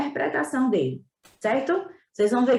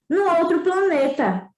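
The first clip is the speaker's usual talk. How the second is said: put-on higher boyish voice; little prince; 6 semitones higher than usual. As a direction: answer brightly as the boy. (voz aguda de menino)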